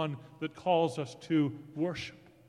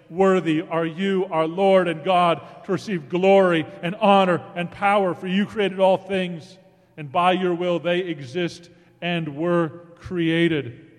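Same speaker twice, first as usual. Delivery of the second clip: preaching; 7 dB louder than usual. Acted. Scripture read at full force.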